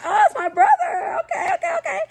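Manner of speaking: high, laughing oh that's my brother; laughing surprise. Retold or imitated. Narrator realizing the girl dates her brother, laughing high.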